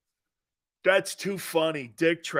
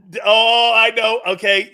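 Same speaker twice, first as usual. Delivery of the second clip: ordinary delivery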